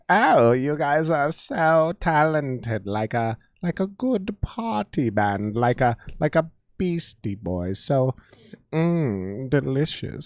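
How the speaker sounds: slow, soft, creepy British lady; creepy British woman. Acted. Co-host showing his best accent, creepy British woman. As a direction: savor it slowly and quietly, in British. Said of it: "is a creepy British woman".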